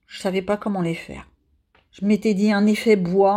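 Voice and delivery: ordinary talk, nothing marked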